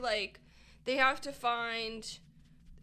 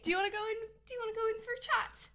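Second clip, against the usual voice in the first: high, fast mock British islander; mock British accent; 10 semitones higher, much faster than usual. Mocking a Love Island lad's chat-up, high mock British accent. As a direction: tease like a Love Island boy pulling a girl for a chat. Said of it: "you're just taking the piss".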